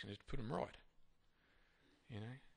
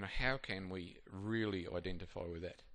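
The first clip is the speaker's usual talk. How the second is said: ordinary talk, nothing marked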